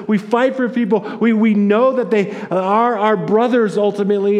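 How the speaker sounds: high, loud, full-force proclamation; impassioned preaching shout. Real, not acted. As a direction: cry it out to the congregation, easing off toward the end. Preacher's emphatic answer to Cain's question.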